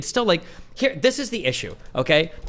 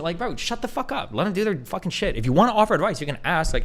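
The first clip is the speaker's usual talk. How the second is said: ordinary delivery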